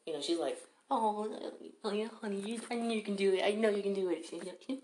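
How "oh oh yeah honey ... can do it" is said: lightly raised, doting mom cheering him on; a hint of a sweet mother coaxing. Mom reassures her son in a lightly raised doting voice.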